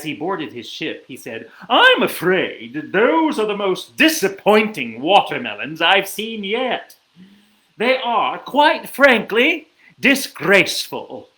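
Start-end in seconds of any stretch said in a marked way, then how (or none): 1.7-11.3 louder, higher, emphatic royal scolding; disappointed king. A narrator's reading. Unimpressed king to villagers as he boards ship, scolding. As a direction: pronounce a grand, indignant royal verdict.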